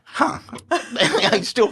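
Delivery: high, laughing "hmm, huh"; laughing relief. Retold or imitated. Reacting to Grace's joke.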